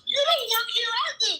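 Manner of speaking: mock protest, high and laughing; laughing disbelief. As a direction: tease her, laughing in disbelief.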